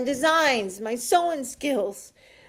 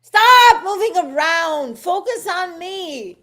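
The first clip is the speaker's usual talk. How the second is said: urgent plea; 6 semitones higher, 6 dB louder, slower than usual. Acted. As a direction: beg for attention, insistent. Pleading with eagle to stop.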